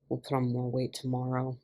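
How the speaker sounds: low, soft grumbling complaint; irritable grumble. A narrator's reading. Mickey grumbling irritably after the workout. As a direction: grumble it, annoyed. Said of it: "Mickey grumbled irritably".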